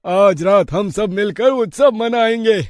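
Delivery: a grateful elder praising the heroes, slow and high; villager, overjoyed. Acted. Village elder welcomes the heroes home, high and slow with joy.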